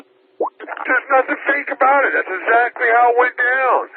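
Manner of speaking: 高く、ゆっくり、憤慨して否定; 憤然と言い返す; indignant protest. Real, not acted. Indignant denial in an argument.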